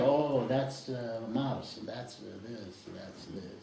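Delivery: imitating a wandering mind, fading into a mumble; distracted murmur. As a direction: trail off low and soft, as if absent-mindedly stargazing.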